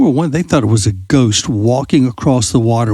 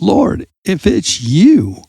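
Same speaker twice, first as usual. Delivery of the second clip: retold yell; 8 semitones higher, slower than usual. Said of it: "he starts yelling out".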